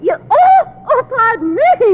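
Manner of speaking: high, loud startled cry of fake surprise; loud high mock startle; feigned surprise. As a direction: act as if she just noticed her.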